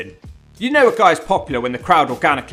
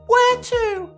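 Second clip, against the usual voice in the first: high squeaky voice. Pretend. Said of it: "David Young asked".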